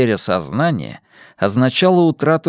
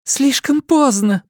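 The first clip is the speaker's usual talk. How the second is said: moaning. A narrator's reading. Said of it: "простонала Немо".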